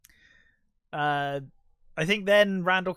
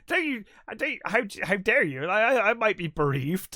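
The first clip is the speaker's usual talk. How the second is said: mock indignation; 5 semitones higher, 3 dB louder than usual. Dante indignantly replies, retold.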